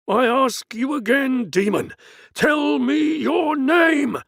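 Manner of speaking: high, slow, full-voiced shout; exorcist's shout. Priest shouting at the demon for its name, furious shout. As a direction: bellow the command at the demon.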